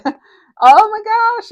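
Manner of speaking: high squeal of surprise at the card; excited exclamation. Real, not acted. Tarot reader thrilled by a card she just pulled, squealing high.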